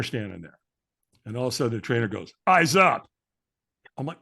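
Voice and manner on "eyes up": short loud trainer's order; barked command. Retold or imitated. Trainer to firewalker at the front of the line, barked command. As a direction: snap him out of staring.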